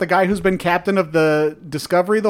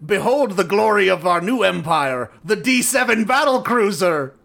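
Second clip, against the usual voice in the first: grand mock Klingon proclamation; broken-accented Klingon herald; old-Klingon accent. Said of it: "this broken accent like old Klingons".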